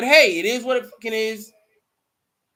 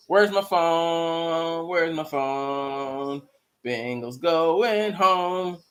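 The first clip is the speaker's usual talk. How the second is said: slow drawn-out; 3 semitones lower, much slower than usual. Real, not acted. Slow sing-song while searching.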